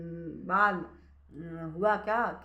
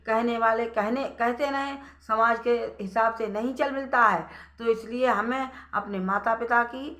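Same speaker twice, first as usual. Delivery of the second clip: loud, earnest preaching voice; storyteller preaching the moral to the audience, earnest and loud; 5 dB louder than usual. Acted. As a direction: drive the lesson home to the listeners.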